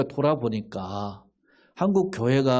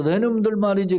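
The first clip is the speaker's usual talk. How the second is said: sneering jibe; 6 semitones higher than usual. Retold or imitated. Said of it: "비아냥거려가지고".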